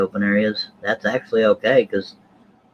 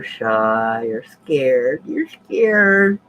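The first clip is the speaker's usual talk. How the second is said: playground taunt, rising high and drawn out; mock jeer, climbing in pitch; teasing taunt; 3 semitones higher, 4 dB louder, much slower than usual. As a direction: taunt them like a kid daring friends. Teasing guests as too scared.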